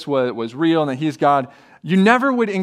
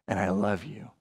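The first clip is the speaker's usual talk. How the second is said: tender; 7 semitones lower, 9 dB quieter than usual. Acted. Jesus' tender words voiced.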